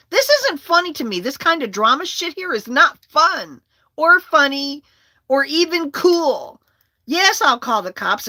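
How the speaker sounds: high, loud indignant tirade; angry retort. Retold or imitated. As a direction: re-enact a furious comeback. Acted angry quarrel, her retort.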